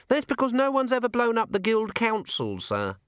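put-on a young dwarf explains his blunder to the commander, eager and high; young dwarf alchemist.

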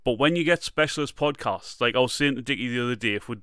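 ordinary delivery

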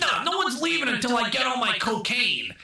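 high hammed-up threat; squeaky tough-guy threat; mock gangster. Retelling the movie, Ray Liotta's high mock threat.

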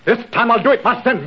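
high, angry outburst at intruders; furious at being disturbed; furious shout. Acted. Enraged lord confronts intruders.

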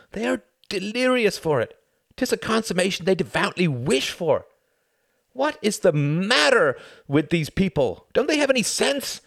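exasperated. A narrator's reading. Tom's exasperated inner rant.

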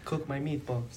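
high, put-on Italian accent; mock Italian accent. Riffing on the co-host's Italian bit, mock Italian accent. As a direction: join in with a joke Italian voice. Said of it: "that even Italian anymore?".

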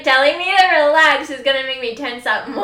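exasperated. Retold or imitated. Friend mock-voices her reaction.

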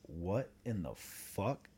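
slow, hushed, drawn-out bafflement; stunned disbelief. Retold or imitated. Telling friends of a garage door opening late, stunned disbelief. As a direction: let each word out slowly and softly, puzzled.

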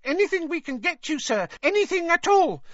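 Fred imitating a house elf for Hermione, high squeak; high-pitched squeak house elf. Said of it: "put on a high-pitched squeak and imitated a house elf".

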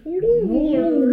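high squeaky sing-song hello; bright squeaky sing-song welcome. Acted. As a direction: chirp a bright high greeting. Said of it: "Is that your character voice?".

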